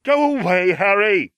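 calling through a door, muffled. Acted. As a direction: shout from behind a closed door. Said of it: "Hermione's muffled voice called".

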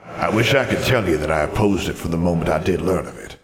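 echoing ghostly wizard voice; formal spectre with a hollow reverb; confessing ghost. Acted. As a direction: admit it gravely as an old phantom.

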